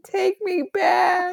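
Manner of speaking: high, slow, hammed-up weepy plea; fake-cry the apology over the phone; mock sobbing. Co-host playing the crying ex-bird on the phone, mock sobbing.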